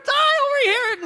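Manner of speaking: very high hysterical fan call; mock shriek to get a star's attention; imitated scream.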